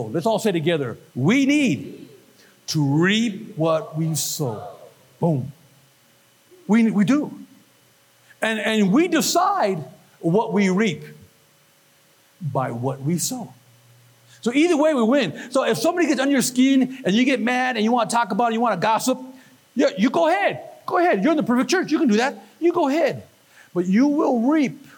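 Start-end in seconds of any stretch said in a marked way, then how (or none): 1.1-4.6 slow, spaced words for a group to follow; deliberate call to say it together; leading a recitation. Shown as a demonstration. Leads congregation saying it together. 19.7-23.2 high-pitched sarcastic encouragement; breezy, higher, ironic go-ahead; mock permission.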